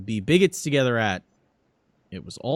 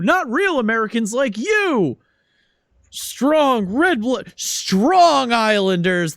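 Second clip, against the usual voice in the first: much higher, louder stump speech with boos and cheers; rallying congressman; 10 semitones higher, 5 dB louder than usual. Acted. Congressman whips up his Long Island crowd, loud and high. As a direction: whip the crowd up, slowing on strong red blood. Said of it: "He says".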